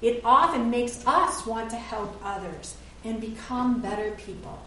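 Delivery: ordinary talk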